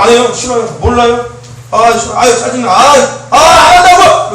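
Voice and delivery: whiny refusals building to a slow angry shout; sulky teen shouting back. Retold or imitated. Pastor imitating a bratty teen refusing, ending in a shout. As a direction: act out a fed-up teen who explodes at the end. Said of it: "이렇게 해버리는 거예요".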